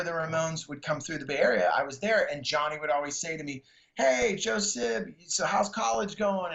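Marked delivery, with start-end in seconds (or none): 4.0-6.5 higher, slow drawn-out greeting. Retold or imitated. [name] asking [name] about college, slow and higher. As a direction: stretch it out like a friendly rocker.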